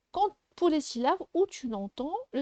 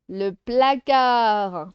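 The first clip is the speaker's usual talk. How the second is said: PLACARD stretched slowly and loudly; drawn out; 6 dB louder, much slower than usual. Shown as a demonstration. Teacher naming the object for pupils, drawn out. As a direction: say PLACARD slow and loud. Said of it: "pour les syllabes".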